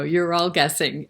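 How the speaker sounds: ordinary delivery, nothing marked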